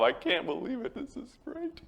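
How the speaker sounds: high, soft mock-thrilled wife; hushed put-on amazement, climbing very high; delighted wife. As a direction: imitate a wife's delight, rising to a tiny squeal. Wife's retold delighted reaction.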